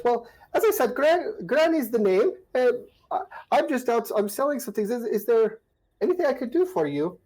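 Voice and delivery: put-on high, sweet old woman voice; old granny voice. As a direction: croon it like a kindly old granny. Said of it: "and she says".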